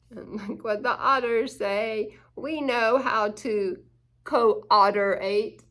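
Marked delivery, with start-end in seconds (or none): 4.2-5.6 slow, louder "co-otterate" drawn out; playful pun. Pretend. Reader showing off the otters' pun, slow and playful. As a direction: savor the silly "co-otterate". Punning on cooperate.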